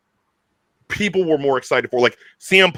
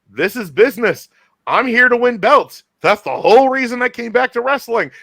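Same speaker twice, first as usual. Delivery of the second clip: mock CM Punk; 9 semitones higher, 4 dB louder than usual. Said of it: "Punk is like".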